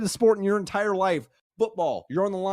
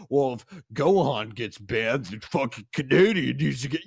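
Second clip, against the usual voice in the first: lower, sneering imitation of a know-it-all fan; mock the fans who say this, lightly; 6 semitones lower than usual. Mocking fans' opinion.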